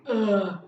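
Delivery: loud exasperated groan; annoyed ugh of disappointment; frustrated ugh. Retold or imitated. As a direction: groan like you're fed up. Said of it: "frustration or disappointment".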